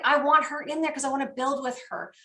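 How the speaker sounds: ordinary talk, nothing marked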